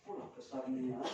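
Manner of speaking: low, hushed repeat; quiet echo. Retold or imitated. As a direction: say it softly, as if puzzling over it. Quietly repeats the overheard shout.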